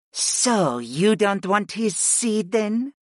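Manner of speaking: slow, high, pointed hiss; hissed challenge. A narrator's reading. Utuni challenges Fi over her turn, hissing. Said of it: "Utuni hissed".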